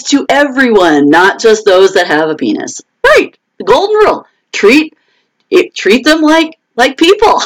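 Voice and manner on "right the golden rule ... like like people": high, emphatic, laughing; emphatic agreement. Real, not acted. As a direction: drive the golden rule home.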